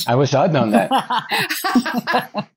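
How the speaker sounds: high, slow echo through a laugh. Real, not acted. [name] teasingly echoes Lori's joke through laughter. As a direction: laugh the line back at her.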